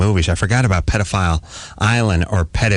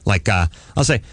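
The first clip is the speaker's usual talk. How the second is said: normal talking voice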